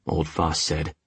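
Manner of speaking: usual speaking voice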